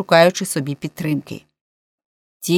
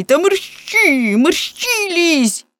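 very high, drawn-out exasperated order; explosive urging; 11 semitones higher, 3 dB louder, much slower than usual. A narrator's reading. Police chief hurrying a man down the well, high exasperated outburst. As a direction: urge him frantically, stretching the words. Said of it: "вибухнув начальник поліції".

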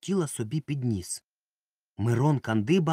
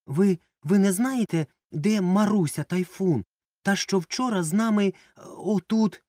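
high, loud flustered blurting; blurted out; 8 semitones higher, 5 dB louder than usual. A narrator's reading. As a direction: stammer it out, blushing. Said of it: "випалив".

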